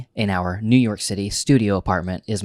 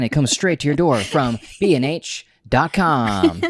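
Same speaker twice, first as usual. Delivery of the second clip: hard sell ad; 6 semitones higher than usual. Acted. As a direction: sell it like a TV pitchman. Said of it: "does that sound like a great infomercial".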